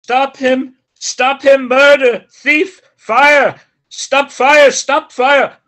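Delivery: loud, frantic cries for help; panicked shouting. Acted. Servant calling for help after a fleeing man, loud panicked shouts. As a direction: yell the alarm across the street.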